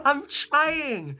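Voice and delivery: high, helpless outburst; flustered protest. Real, not acted. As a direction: protest high as if at breaking point. Flustered, struggling with the Gaelic test.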